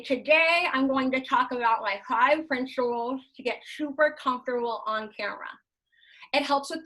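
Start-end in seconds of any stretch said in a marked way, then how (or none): 0.0-5.5 talking around a pen held in the mouth; pen in teeth. Shown as a demonstration. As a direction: say it with a pen between your teeth. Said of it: "I put the pen in my mouth".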